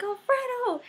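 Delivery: high-pitched, thrilled fan cry; excited call-out. Retold or imitated. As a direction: re-enact calling out to a star you just spotted. Calling out to Alfredo on sight.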